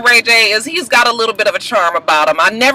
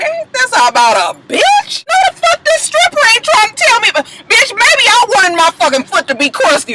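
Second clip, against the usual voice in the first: high shrieking outrage, much higher; 13 semitones higher than usual. Retold or imitated. Vlogger mock-screams at stripper about crusty feet, high shriek. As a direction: scream it as if insulted. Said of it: "I said".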